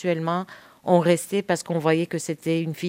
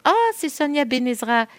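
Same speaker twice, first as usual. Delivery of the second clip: excited fan recognition; 8 semitones higher than usual. Retold or imitated. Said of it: "c'est toujours".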